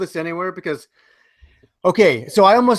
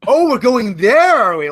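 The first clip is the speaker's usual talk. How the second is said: slow, higher and louder sarcastic retort; mock indignation; 4 semitones higher, 4 dB louder than usual. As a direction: draw it out in exaggerated disbelief. Retorting to the fanboy in story.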